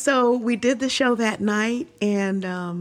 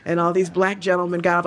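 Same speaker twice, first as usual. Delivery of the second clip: ordinary talk, nothing marked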